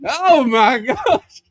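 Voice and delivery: loud, high, long drawn-out exclamation; appalled inspector. Retold or imitated. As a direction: wail it in hammed-up horror. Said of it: "he walks by and he's like".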